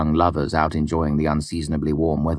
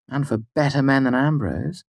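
high bright teasing woman's voice; playful teasing woman; 9 semitones higher than usual. Acted. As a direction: tease her fondly.

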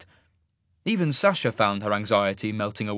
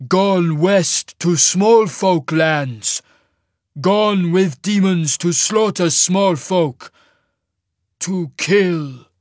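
very slow, loud, booming giant; rumbling giant; 7 semitones higher, 8 dB louder, much slower than usual. A narrator's reading. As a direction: heave out each phrase like an avalanche. Giant's character voice.

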